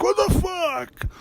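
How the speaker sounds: high, drawn-out "What the fuck?" yelled in shock; stunned shout. Retold or imitated. Imagining yelling at his toddler self, stunned high shout. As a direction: yell it out slow and high, floored.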